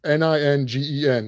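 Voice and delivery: each letter of N I N G E N said separately; spelled out. Shown as a demonstration. Host spelling N I N G E N for viewers, letter by letter. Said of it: "let's spell it".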